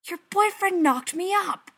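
high, loud, indignant accusation; outraged high-pitched outburst; angry hiss back. A narrator's reading. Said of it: "Gerard hisses back".

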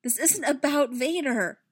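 high, urgent plea; begging. A narrator's reading. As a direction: plead loudly to be heard. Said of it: "Padme begged".

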